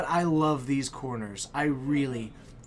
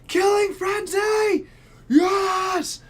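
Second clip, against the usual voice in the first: very high, loud shouted cheer; screaming hype call; 8 dB louder, much slower than usual. Real, not acted. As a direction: yell it out like a stream alert. Stream alert hype shout.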